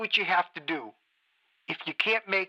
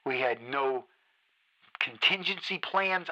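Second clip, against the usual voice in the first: much lower and softer, bitter and drained; quiet seething despair; 9 semitones lower, 6 dB quieter than usual. Acted. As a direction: let the anger collapse into a low murmur. Host sinks into bitter despair.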